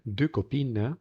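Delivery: DEUX COPINES as "duh kopeen", x and s silent; correct French finals. Shown as a demonstration. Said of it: "ahí no decimos".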